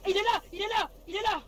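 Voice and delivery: high, loud alarm shout; excited shout. Real, not acted. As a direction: yell to alert the squad. Spotting the enemy.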